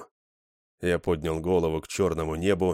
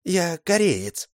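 gentle correction; 10 semitones higher, 3 dB louder, much slower than usual. Acted. The Korean gently correcting Mol, high and slow. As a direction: correct him politely, slowly. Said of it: "мягко поправил его азиат".

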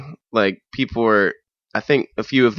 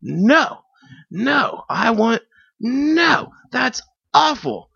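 very high, slow squealing refusal; disgusted drunk girl; 14 semitones higher, much slower than usual. Retold or imitated. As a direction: shriek your disgust. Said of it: "she was like".

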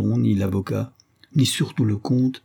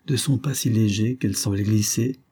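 ordinary delivery, nothing marked

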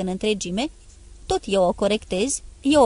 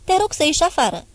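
high, louder order to leave; clipped angry command; curt dismissal; 7 semitones higher, 3 dB louder than usual. Acted. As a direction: show him the door. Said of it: "i-am arătat ușa scurt".

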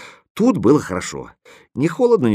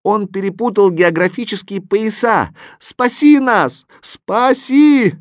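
high cries growing louder; high, urgent begging at full voice; pleading shouts; 10 semitones higher, 4 dB louder than usual. A narrator's reading. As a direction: shout for rescue, rising to drawn-out cries. Chorus accuses and begs for rescue.

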